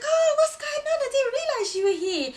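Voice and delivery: high, loud mock-innocent surprise; mocking cousin. Cousin bursting into the hairdresser's, mock high surprise. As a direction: squeal it like a fake-innocent cousin. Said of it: "and she's like".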